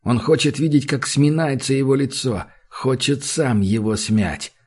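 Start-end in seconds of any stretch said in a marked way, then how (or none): none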